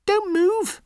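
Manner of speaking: very high tiny mouse voice; very high mouse giving orders; brave little mouse. Acted. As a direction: pitch way up for a small determined mouse. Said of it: "says the mouse".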